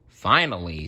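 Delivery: ordinary delivery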